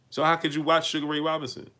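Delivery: ordinary delivery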